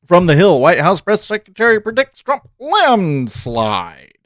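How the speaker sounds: put-on old Hollywood announcer voice; mid-Atlantic accent. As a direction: read it like a 1940s newsreel. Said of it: "my mid-Atlantic accent".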